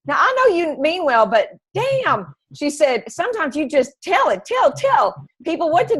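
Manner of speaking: country friend's voice. Retold or imitated. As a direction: play her frank country best friend. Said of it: "she's got this really strong accent".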